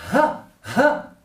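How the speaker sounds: "Ja" as a breathy Arabic ḥ from the throat; throaty exhaled "Ja", like breathing on glass; fogging the glass. Shown as a demonstration. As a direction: huff "Ja" as if misting a pane to clean it. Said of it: "Limpia el cristal".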